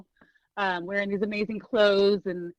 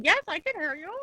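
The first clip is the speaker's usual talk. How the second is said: dog's voice; 8 semitones higher than usual. Retold or imitated. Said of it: "He said".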